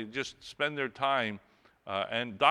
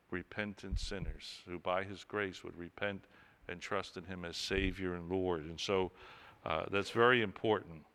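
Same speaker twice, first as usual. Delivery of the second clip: normal talking voice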